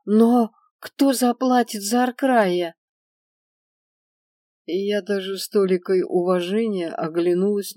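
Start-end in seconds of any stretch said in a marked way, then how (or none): 0.0-2.7 high, slow nervous question; nervous plea. A narrator's reading. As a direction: ask nervously, voice lifting. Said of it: "трясущимся голосом".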